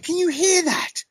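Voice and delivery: very high, slow startled question; high, hushed-by-nothing startled query; retold alarm. Retelling alarm at mysterious thumping.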